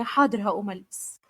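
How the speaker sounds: ordinary delivery, nothing marked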